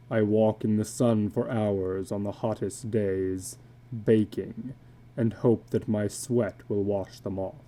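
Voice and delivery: a weary man confessing what he can't wash off; illustrated man. Acted. Illustrated man speaks of tattoos.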